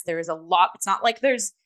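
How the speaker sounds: ordinary talk, nothing marked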